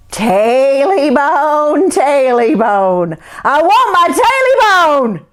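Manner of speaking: slow, high, wailing ghost chant; eerie creature voice. Acted. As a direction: drag out each word like a haunting. Creature voice, closer.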